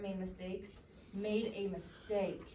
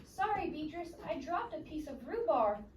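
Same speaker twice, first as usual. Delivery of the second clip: apologetic friend voice; 7 semitones higher, 4 dB louder than usual. A narrator's reading.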